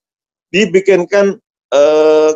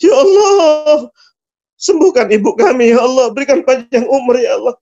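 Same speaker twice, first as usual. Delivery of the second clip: tearful plea; 6 semitones higher than usual. Acted. Son begs God to heal his sick mother, tearful plea. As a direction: plead through tears, voice raised high. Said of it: "Nangis Uwais Al-Qarni".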